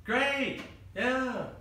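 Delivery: high, chirpy put-on reply; overeager cover-up. Student hiding Bible study from a girl, overeager. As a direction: answer too brightly, hiding something.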